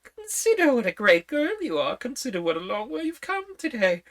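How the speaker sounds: put-on high, fussy old queen. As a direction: talk up high like a flustered queen.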